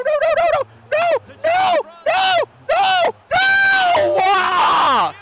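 very high, loud cries of despair; despairing shouts. Real, not acted. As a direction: scream as the goal goes in. Opponent about to score.

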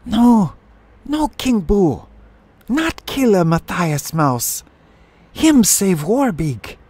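put-on high, loud young sparrow pleading; desperate squawked plea to a ruler; appealing for mercy. A narrator's reading. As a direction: beg urgently in a shrill bird cry. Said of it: "called out to her uncle, appealing for mercy".

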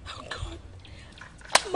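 whispered gasp of realisation; dismayed whisper. Acted. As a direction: whisper it as the mistake dawns. Wife realises soup was forgotten.

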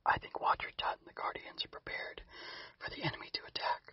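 a faint, hushed whisper. Acted. Quiver leaning close to Chirp, secret whisper. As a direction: whisper it so nobody overhears. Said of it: "somehow whispered more quietly than he had before".

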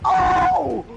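sudden high shocked yelp; startled yelp. Real, not acted. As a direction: yelp in fright. Caught by the killer.

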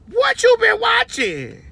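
high shocked shout, slowing down. Retold or imitated. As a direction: yell it in disbelief at your wife. Husband's shocked repeat, shouted.

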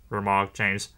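ordinary delivery

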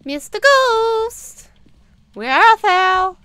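slow, high, loud hammy summons; theatrical calling out. Pretend. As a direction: call the ghost like a stage actor.

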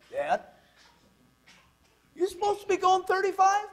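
high-pitched mock kid voice; imitated questioning child. Son asking Dad about his speed, imitated child voice.

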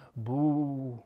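low, drawn-out moo; low animal boo; beast's moo. A narrator's reading.

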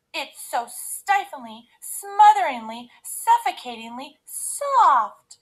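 high, loud, building complaint; theatrical moaning. A narrator's reading. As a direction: moan each word bigger, as if smothered. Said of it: "she moaned".

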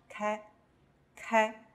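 开 with a k sound, said as one syllable; hard k sound. Shown as a demonstration. Teacher showing a three-letter combination, 开 with hard k. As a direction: model 开 with hard k, then repeat it. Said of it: "我们就把它念成".

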